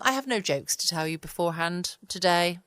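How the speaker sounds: ordinary talk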